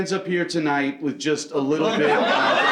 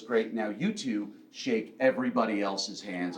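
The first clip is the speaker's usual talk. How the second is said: teacher instruction; 6 semitones lower, 7 dB quieter than usual. Retold or imitated. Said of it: "the woman goes".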